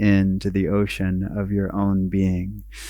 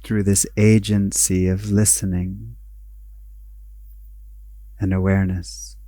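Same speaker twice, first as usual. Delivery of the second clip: guided meditation; 6 dB quieter than usual. Acted. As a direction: guide them inward, unhurried, letting each phrase settle.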